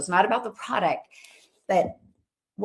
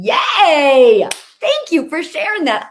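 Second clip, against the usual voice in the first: loud, high delighted yay; cheer; 6 semitones higher, 11 dB louder, slower than usual. Real, not acted. Cheering a viewer's million-dollar plan, loud delighted yay.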